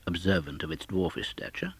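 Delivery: plain reading voice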